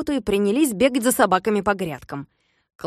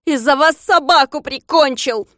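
loud, higher accusing shout; angry blaming; 6 semitones higher, 6 dB louder than usual. Acted. Klops blaming servants for his dead dog, angry shout. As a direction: blame them bitterly.

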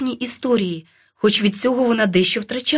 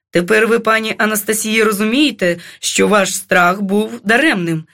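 ordinary delivery

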